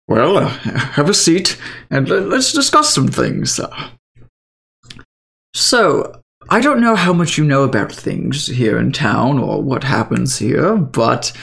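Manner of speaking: a hint of a chirpy old man; old gentleman. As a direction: settle in like a kindly old mentor.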